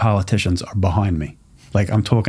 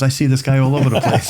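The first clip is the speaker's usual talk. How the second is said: laughing quote; 4 semitones higher than usual. Retold or imitated. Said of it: "He goes".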